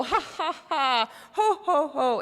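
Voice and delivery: very high staged ha ha ho ho; pointing fake laugh. Pointing at a classmate and forcing a laugh. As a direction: laugh at your partner on command. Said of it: "point at you and go".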